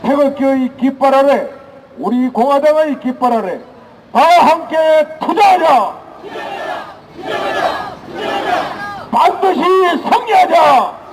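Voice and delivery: builds from firm to shouted cries; rally the crowd into shouting; roaring rallying speech. Acted. Rally cries ending the party statement.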